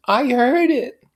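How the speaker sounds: high, loud complaint; high shrill complaint; complaining. A narrator's reading. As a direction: whine it out like a spoiled wife. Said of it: "she complained".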